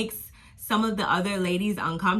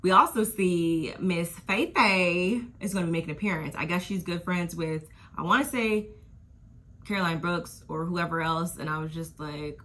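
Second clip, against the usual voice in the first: normal talking voice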